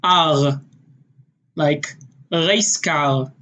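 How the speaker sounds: R and reis car with a guttural Israeli R from the back of the throat, slow; Israeli accent. Shown as a demonstration. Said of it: "more in the back of your throat".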